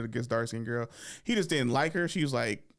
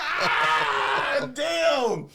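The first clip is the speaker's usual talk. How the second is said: high, loud, long comic scream; wounded yell; 6 dB louder than usual. Acted. Boy hit by cousin's oil spill joke, wounded yell. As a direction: howl as if the joke just hit you. Acts out being burned by joke.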